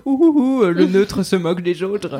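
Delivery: high, loud, slow mocking hoots of a smug metropolitan French; mocking snobby French. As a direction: ham up the smug Frenchman who thinks he has no accent. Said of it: "en mode".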